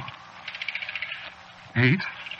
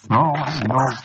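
usual speaking voice

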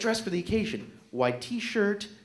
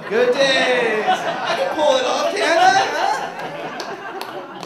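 louder and higher, laughing through it; laughing brag; 8 semitones higher, 9 dB louder, much slower than usual. Real, not acted. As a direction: preen and laugh at yourself. Showing off his whorebilly look.

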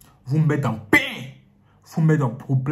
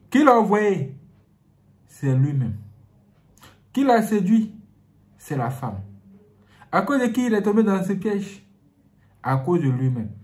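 preaching; 6 semitones higher, 6 dB louder than usual. Acted. Preacher asks and answers loudly.

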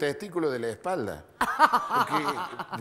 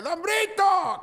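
loud, high holler; shouted call; 7 dB louder, much slower than usual. Acted. Cousin hailing the old man in the yard, shouting. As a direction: yell the name as if he were far away. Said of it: "No grite ... está gritando en un panteón".

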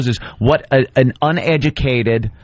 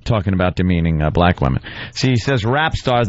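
ordinary talk, nothing marked

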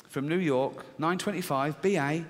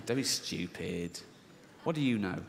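dismissive inner voice; 8 semitones lower, 7 dB quieter than usual. Retold or imitated. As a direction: brush the fear off from down low. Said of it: "this one goes".

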